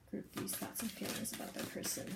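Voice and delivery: whispered question. Acted. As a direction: breathe it out without voice. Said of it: "repeated question, whispered take".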